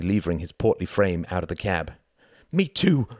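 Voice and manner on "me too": high, loud call; crying out loudly. A narrator's reading. Wendy jerking out of the cab after Quentin, crying loudly. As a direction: yell it while following him out. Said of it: "cried Wendy, loudly".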